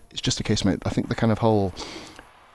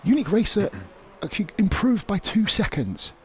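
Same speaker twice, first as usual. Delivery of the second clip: much higher, amazed commentator; excited surprise; 11 semitones higher than usual. Real, not acted. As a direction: caught off guard by a fast lap. Spots a big lap improvement.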